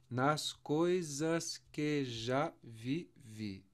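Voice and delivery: very slow, one syllable at a time; evenly spaced syllables for a class; separated syllables. Shown as a demonstration. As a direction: count the beats of the verse aloud. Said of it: "Deixa eu separar aqui".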